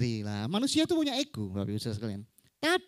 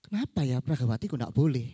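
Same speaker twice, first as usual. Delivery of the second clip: lower, musing puzzled question; puzzled child; 4 semitones lower, faster than usual. Acted. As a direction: wonder to yourself as a confused child. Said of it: "Anaknya bingung ini". (anak bingung bertanya-tanya)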